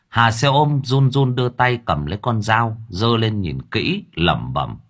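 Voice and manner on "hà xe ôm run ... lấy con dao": ordinary delivery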